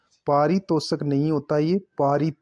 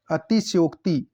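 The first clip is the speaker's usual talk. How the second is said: normal talking voice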